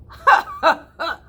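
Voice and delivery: high, loud scornful ha ha ha; mocking laugh. City Mouse laughing at his cousin's beets, loud and mocking. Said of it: "laughed the City Mouse".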